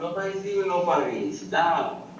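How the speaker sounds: pleading protest. Real, not acted. Protesting being left.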